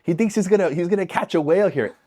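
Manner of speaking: put-on high jeering kid voice; mock him with a laugh in the voice. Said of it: "they're just cracking up".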